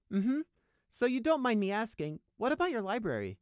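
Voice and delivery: high-pitched, feigned innocent asking; leading question. A narrator's reading. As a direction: slip the real question in sweetly. Leading casually to his real question.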